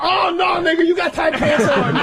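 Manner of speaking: high-pitched mocking whoop; hammed-up heckling. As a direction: cut in crowing over the comeback. Acted heckler drowning out a comeback.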